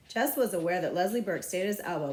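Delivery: usual speaking voice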